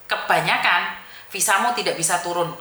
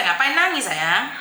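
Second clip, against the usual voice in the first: ordinary talk, nothing marked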